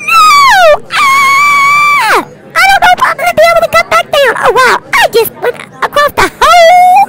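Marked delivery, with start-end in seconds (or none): 0.1-2.2 mock panicked scream. Kids' roleplay, dog chased away.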